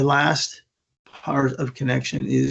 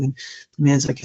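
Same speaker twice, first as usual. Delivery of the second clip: usual speaking voice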